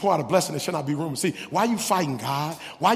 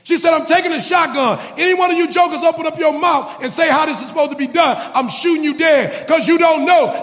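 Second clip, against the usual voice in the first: raised, scolding sermon delivery; forceful preaching; 10 semitones higher, 8 dB louder than usual. Real, not acted. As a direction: build to a high, loud preacher's rebuke. Preaches and acts shotgun threat.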